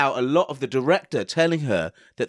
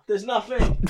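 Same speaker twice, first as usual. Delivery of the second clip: cracking up mid-line; 10 semitones higher than usual. Real, not acted. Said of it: "I've got the giggles".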